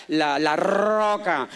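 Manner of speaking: "roca" with a long, hard rolled R; drawn-out trilled R on "roca"; exaggerated R. Shown as a demonstration. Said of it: "yo pronunciaba la R".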